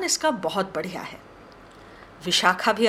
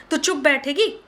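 scolding; 6 semitones higher than usual. Acted. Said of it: "Vishakha ने डांट लगाई".

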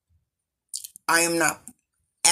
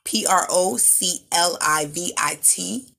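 ordinary delivery

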